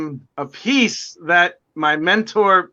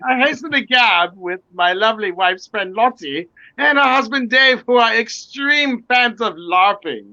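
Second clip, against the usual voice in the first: hammed-up British gentility, lilting upward; mock-British tea-party voice; 5 semitones higher than usual. Joking to friends about crumpets and tea, mock-British. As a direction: play the fancy British tea guest.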